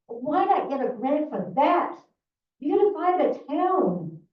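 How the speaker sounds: loud, heated demand; angry. Real, not acted. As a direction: hammer the point home loudly. Heated public complaint.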